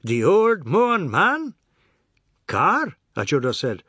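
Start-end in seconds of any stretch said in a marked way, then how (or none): 0.0-1.5 loud, high, hearty teasing; booming amusement, pitched high; jovial roar. A narrator's reading. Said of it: "Jagdat roared, as at a good joke". 2.5-3.0 high, loud querying tone; peevish, confused one-word question; puzzled, petulant. A narrator's reading. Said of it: "puzzled, petulant".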